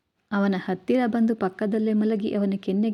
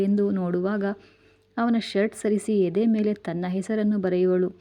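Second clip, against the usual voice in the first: ordinary delivery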